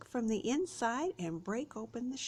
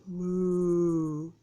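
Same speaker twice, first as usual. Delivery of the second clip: cow moo; 2 semitones lower, 7 dB louder than usual. Shown as a demonstration. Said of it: "What do we have here".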